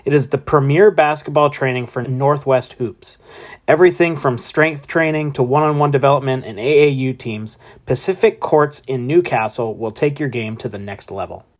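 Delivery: loud, upbeat sponsor read. Acted. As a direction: sell it like a radio spot. Reads sponsor ad.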